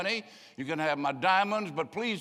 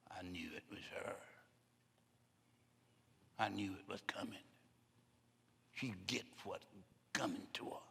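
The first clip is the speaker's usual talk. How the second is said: much softer, hushed whispering gossip; gossipy whisper; 6 semitones lower, 7 dB quieter than usual. Retold or imitated. Preacher voices church gossips whispering about someone, hushed.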